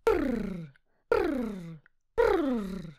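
lip-trill snort; horse snorting. Acted. Reading the poem to kids, a pony's lip-trill snort. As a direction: snort like a pony. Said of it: "Konik parska".

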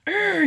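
high, loud exasperated urgh; frustrated groan. Retold or imitated. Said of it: "And I was like".

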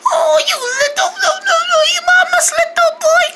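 high, gushing coo; doting, sing-song fussing; cooing baby talk. Pretend. Cooing over the gator baby.

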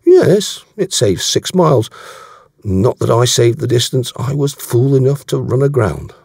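emphatic, candid character voice; Davies, frank. Acted. Voicing Davies in dialogue.

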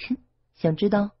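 put-on high, coy teasing girl's voice. A narrator's reading. Guihu teasing Yang Luo about her true face, coy high tease. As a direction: tease him with a little scoff. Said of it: "鬼狐一笑".

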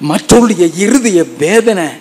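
normal talking voice

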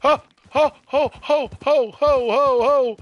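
loud high calls to a horse; calling out. Real, not acted. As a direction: shout to rein the horse in. Reining in a spooked horse.

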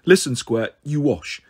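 ordinary delivery

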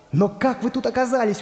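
high-pitched astonishment; surprised question. Acted. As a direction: ask in amazement, voice lifted high. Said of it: "удивился волшебный художник".

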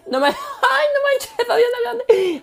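squealing very high in delight; fangirl squeal. Acted. Starstruck fan meeting [name], squealing very high.

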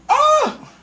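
loud high shriek; high scream. Real, not acted. Teen screams as fire flares. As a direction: shriek at the burst of flame.

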